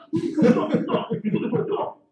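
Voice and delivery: high, puzzled dog voice, slowing on the repeat; lightly voiced confused dog. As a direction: voice the dog looking around, baffled. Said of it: "the dog looks around, like".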